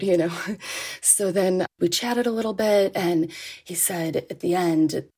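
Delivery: ordinary delivery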